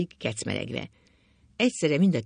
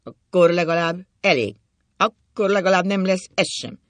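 loud, raised-voice defiance; defiant outburst; 5 semitones higher, 8 dB louder than usual. A narrator's reading. Drunk husband wishing the house burnt, loud and defiant. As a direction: throw it back at her forcefully.

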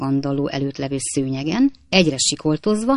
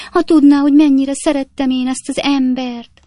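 lament; 9 semitones higher, 7 dB louder than usual. A narrator's reading. Wife lamenting to maid.

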